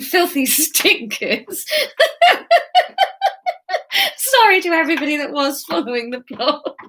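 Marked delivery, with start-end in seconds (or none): 1.7-4.1 loud laugh. Real, not acted. Laughing at own story.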